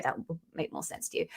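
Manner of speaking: soft aside. Real, not acted. Aside after plugging the freebie.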